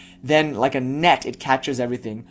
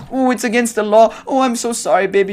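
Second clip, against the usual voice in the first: higher, louder mock-pitying whine; sarcastic teacher mocking a timid student; mocking sympathy; 7 semitones higher, 4 dB louder than usual. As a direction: taunt them in a fake soothing voice. Mocking a fearful rule-follower.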